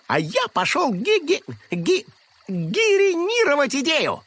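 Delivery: гиринировать stammered fast; stumbling mispronunciation. A narrator's reading. Krosh stumbles on word.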